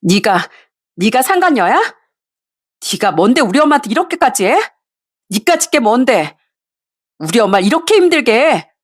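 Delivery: high angry confrontation; furious yelling. Acted. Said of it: "소리를 치더라고요".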